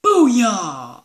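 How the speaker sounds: loud triumphant whoop; cheer. Acted. Link cheers the team name, loud. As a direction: finish the intro with a bang.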